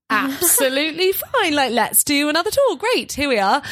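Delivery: high, chirpy British tour-guide voice; British accent. Retold or imitated. Guide agreeing to another tour, stuck in British accent. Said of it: "they'd heard me use my British accent".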